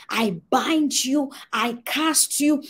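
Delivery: slow, forceful casting out of a spirit; slow commanding rebuke. Retold or imitated. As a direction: bind it out word by word like a deliverance prayer. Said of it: "I shouted and I said".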